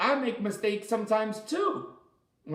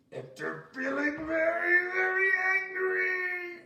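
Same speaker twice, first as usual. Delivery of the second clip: high, slow mock angry wail; playful teacher wail; mock angry cry; 10 semitones higher, slower than usual. Shown as a demonstration. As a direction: stretch it out high like a pretend tantrum. Teacher acting out angry feeling.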